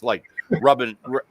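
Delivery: ordinary delivery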